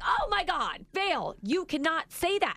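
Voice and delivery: high, exasperated mock telling-off; exasperated mom, imitated; retold scolding. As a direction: scold like an appalled parent.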